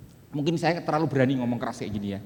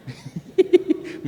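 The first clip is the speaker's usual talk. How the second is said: breathy laugh; 12 semitones higher, 4 dB louder than usual. Real, not acted. Laughs at his own joke.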